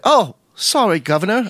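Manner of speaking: hammed-up, badly faked Canadian apology; failed Canadian accent. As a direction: botch a polite Canadian accent. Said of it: "I can't do a Canadian accent".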